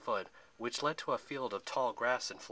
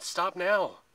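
high, loud panicked shout; urgent cry; 7 semitones higher, 8 dB louder than usual. A narrator's reading. Percy warns Zoe at the wheel, urgent high shout. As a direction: cry out a sudden warning. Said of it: "he cried".

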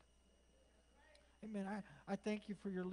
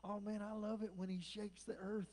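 ordinary talk

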